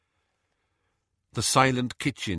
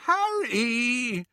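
much higher drawn-out wail. Acted. Hermione reproaches Harry, a high wail. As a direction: wail his name in dismay. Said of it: "wailed Hermione".

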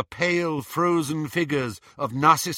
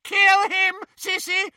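much higher, louder shriek; 6 dB louder than usual. A narrator's reading. Bellatrix to Narcissa, shrieked order. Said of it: "shrieked Bellatrix".

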